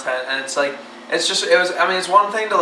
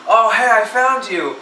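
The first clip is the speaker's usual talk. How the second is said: higher-pitched, drawn-out greeting in a put-on heavy accent; Pyro's heavy accent; 7 semitones higher, slower than usual. Friend spotting the group in line, heavy-accented imitation. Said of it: "obviously in his heavy, awesome accent".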